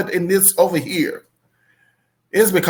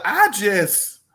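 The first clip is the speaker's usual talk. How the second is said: usual speaking voice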